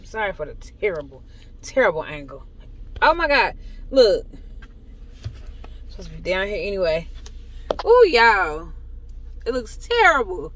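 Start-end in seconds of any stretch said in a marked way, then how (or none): 3.0-10.5 shocked exclaim. Real, not acted. Shocked at her own look.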